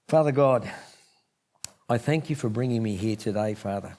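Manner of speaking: hushed prayer fading to almost nothing; quiet heartfelt prayer to close; closing prayer. Acted. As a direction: pray softly, letting the voice sink away.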